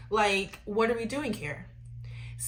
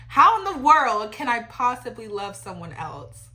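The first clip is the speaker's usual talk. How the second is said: ordinary delivery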